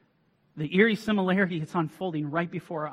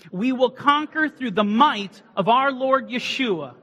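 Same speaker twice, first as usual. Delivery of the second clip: loud, high, slow rallying chant; rally the crowd, every word hammered; battle cry; 8 semitones higher, 7 dB louder, slower than usual. Acted. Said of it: "We're gonna say the battle cry".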